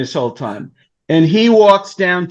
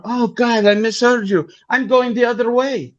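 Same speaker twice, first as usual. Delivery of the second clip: higher, light woman's voice; Italian woman voice; 7 semitones higher than usual. Retold or imitated. Italian woman asks where his car is, in a higher voice. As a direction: voice her lightly and airily. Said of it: "I said".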